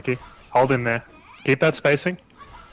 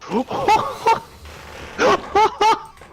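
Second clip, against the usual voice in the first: big laugh. Real, not acted. Player gets thrown after raw trigger.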